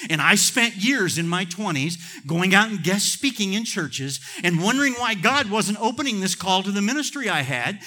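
loud, high, driving pulpit delivery; full-force preaching. Real, not acted. Preacher telling church God belongs in everyday life, full-force preaching. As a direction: preach it hard, with sustained fervor.

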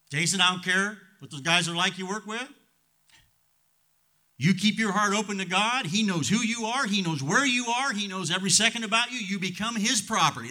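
preacher exhorting Jason; fervent exhortation. Acted. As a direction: urge him with conviction. Exhorting a listener by name.

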